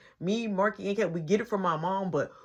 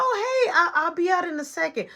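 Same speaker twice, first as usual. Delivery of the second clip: much higher, louder voice; high mimicking voice; high-pitched retold reply; 9 semitones higher, 4 dB louder than usual. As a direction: call it out high and quick, imitating her. Retold sister's reply from behind door.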